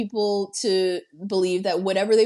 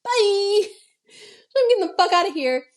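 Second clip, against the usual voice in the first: high, loud, fast bail-out; high loud rushed goodbye in a panic; panicked exit; 10 semitones higher, 5 dB louder than usual. Retold or imitated. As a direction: blurt it and flee, high and loud. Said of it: "Catherine's like".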